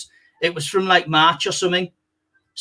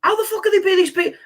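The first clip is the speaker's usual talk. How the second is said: indignant outburst; 11 semitones higher, faster than usual. Retold or imitated. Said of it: "you're going".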